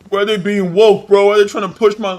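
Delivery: high, loud sneering whine; mocking whiny gamer. Streamer parodies gamers whining about a woke game, in a high loud whine. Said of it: "Oh, well".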